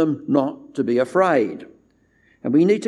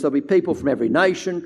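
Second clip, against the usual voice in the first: usual speaking voice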